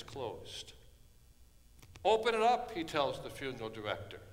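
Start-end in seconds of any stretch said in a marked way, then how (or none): 2.0-2.7 firm raised command, pitched up; commanding order. Acted. Jesus orders the funeral director, raised command. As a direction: order the lid opened, loud and sure.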